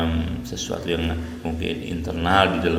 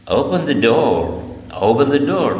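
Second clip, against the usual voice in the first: second "open the door" louder and higher, exasperated; insist, getting irritated; mock annoyed command; 4 semitones higher, 4 dB louder than usual. Shown as a demonstration. Teacher acting annoyed that the door stays closed. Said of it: "rasa jengkel".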